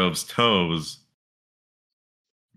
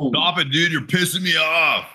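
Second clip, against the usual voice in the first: mock angry shout; 8 semitones higher than usual. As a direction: fake outrage, shouted high. Friends trading mock compliments.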